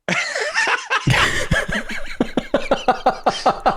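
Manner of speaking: long, high laugh; big laugh. Real, not acted. Loses control of the show.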